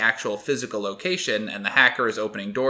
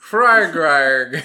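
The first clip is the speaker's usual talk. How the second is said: high loud mocking frog frog; mock high frog call; 5 semitones higher, 8 dB louder than usual. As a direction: shout it high to tease her. Don't make fun of me.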